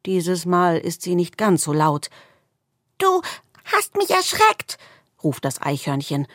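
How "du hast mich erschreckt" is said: trembling voice. A narrator's reading. Squirrel calls to the darkness, voice trembling. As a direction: quaver like a frightened little squirrel. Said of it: "Seine Stimme zittert".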